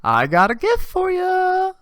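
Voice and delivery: high scratchy teacher voice, sing-song offer; teacher's voice. Retold or imitated. Teacher teasing the class with a mystery gift, high scratchy voice. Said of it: "her voice isn't as scratchy".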